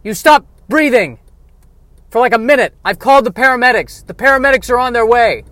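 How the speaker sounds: frantic, near shouting; panicked. Acted. Panicked host after guest stops breathing.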